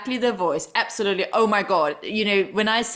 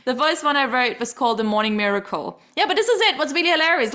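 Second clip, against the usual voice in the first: normal talking voice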